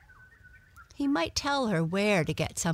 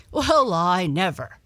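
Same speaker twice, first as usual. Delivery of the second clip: loud astonished dog's bark; booming exclamation from an amazed dog; 2 semitones higher, 10 dB louder than usual. A narrator's reading. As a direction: bark it out, much louder. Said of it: "barked Don".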